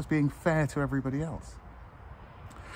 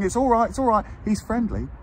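much higher, louder mock "it's all right"; hammed-up owner shouting reassurance, higher; imitating cheery owner; 11 semitones higher, 3 dB louder than usual. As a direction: call out breezily across the park like the careless owner. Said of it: "and you're going".

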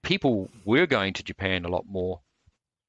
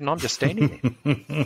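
laughing; 6 semitones higher than usual. Retold or imitated. Quoting balance story, laughing.

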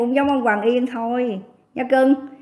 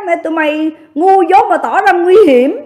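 angry rant; 4 semitones higher, 5 dB louder than usual. Real, not acted.

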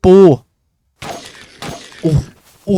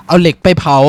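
louder, firmly fixing the slip of the tongue; loud self-correction; 3 semitones higher, 10 dB louder than usual. Real, not acted. Correcting his own misspoken crafting step, louder. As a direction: snap back to the right word. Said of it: "ลิ้นเป็นเหี้ยอะไรเนี่ย".

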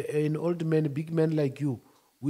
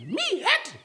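high, scoffing Me hurt; mock disbelief; 13 semitones higher than usual. Repeating the question to his friend, mock disbelief. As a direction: scoff at the very notion.

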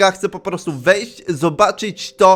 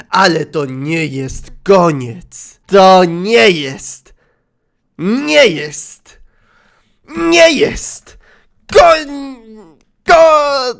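slow, loud, mounting theatrical wail; melodramatic anguish; 3 semitones higher, 7 dB louder, much slower than usual. Pretend. Heartbroken Yandere refuses the end, melodramatic wail. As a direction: drag each word out, building to a mock sob.

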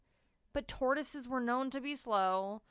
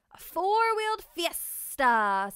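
slow, louder chiming exclamation; 6 semitones higher, 9 dB louder, slower than usual. Acted. Sebastian chiming in about the bus party, slow and bright. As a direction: sing it out slowly. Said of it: "Sebastian chimed in".

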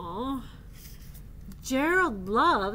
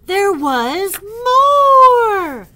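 building elephant wail; 6 semitones higher, 13 dB louder, slower than usual. Acted.

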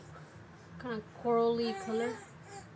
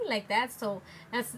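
usual speaking voice

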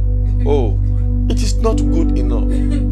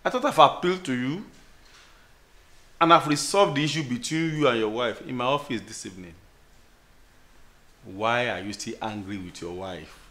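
gentle pastoral; 6 semitones lower, 6 dB quieter than usual. Acted. Pastor calls brother gently.

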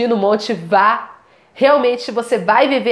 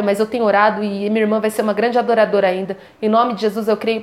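ordinary delivery

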